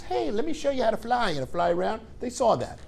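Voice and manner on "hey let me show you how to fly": higher, softer mock mother bird; lightly sung-out mommy encouraging her baby. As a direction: coax the chick like a sweet mama bird. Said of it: "She goes".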